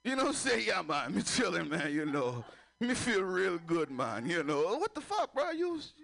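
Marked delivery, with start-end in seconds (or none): 0.7-4.8 put-on slow, relaxed Jamaican accent; stoned friend in Jamaican accent. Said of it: "developed a Jamaican accent". 4.8-5.9 high, incredulous outburst; baffled at his buddy; mock disbelief; shocked. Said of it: "reacting to friend".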